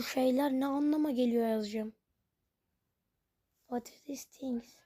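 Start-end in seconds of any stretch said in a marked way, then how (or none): 3.6-4.7 whisper. Real, not acted. Reading chat question softly.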